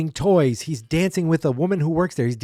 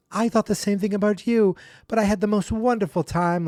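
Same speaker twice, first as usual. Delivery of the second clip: put-on high kid voice; 8 semitones higher than usual. Melvin answers aunt.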